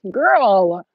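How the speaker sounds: loud, high amused exclaim; amused disbelief. Real, not acted. Teasing a friend on stream, loud high amused exclaim. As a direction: tease her through a laugh. Laughing exasperation at someone.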